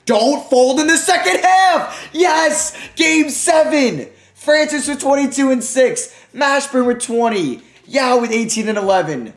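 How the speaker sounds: high, loud, slow triumphant yelling; ecstatic shouting. Real, not acted. Gamer celebrating forcing a game seven, ecstatic shouting. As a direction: scream it with pure joy.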